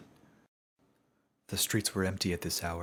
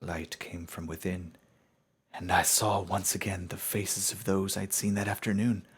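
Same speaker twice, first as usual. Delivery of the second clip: ordinary delivery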